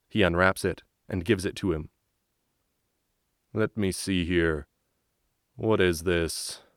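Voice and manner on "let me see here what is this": slow, puzzled soldier. Acted. Guard reads letter.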